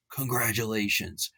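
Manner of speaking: whispered, dry congratulations; sarcastic whisper. Acted. A host mocking a team with a whispered jab. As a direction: breathe it out with mock praise. Sarcastic aside after a dig.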